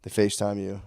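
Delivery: ordinary talk, nothing marked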